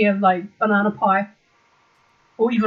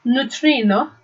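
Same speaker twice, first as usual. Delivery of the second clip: accidental Italian; 2 semitones higher, 3 dB louder, slower than usual. Real, not acted. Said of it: "are you Italian now?".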